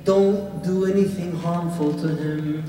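loud, high preaching at full force; fiery sermon. Acted.

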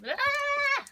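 sudden high cry of surprise; a real startled shriek at the urchin; startled yelp. Real, not acted. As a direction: jump at it with a sharp high yelp.

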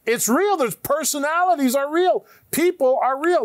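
high, loud emphatic preaching; rising preacher fervor; fervent insistence. Acted. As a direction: hammer home that it's real.

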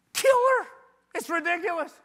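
very high, loud hammed-up shout; thrilled boast. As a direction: belt it out like a friend bragging. Retold friend's thrilled answer.

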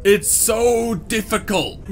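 high, slow, drawn-out whine; whiny complaint. Real, not acted. Player complaining after drowning, whiny. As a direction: stretch it into a whine.

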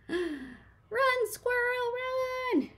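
very high frantic shout to flee; warning a squirrel to flee; panicked cry. Acted. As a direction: yelp it in a panic.

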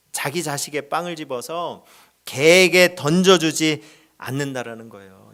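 ordinary talk, nothing marked

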